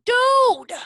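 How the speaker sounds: high, loud startled Dude; mock alarmed shout. Son hearing his mom's call, high loud yelp. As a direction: yelp it like a kid caught out. Son's dread of the talk.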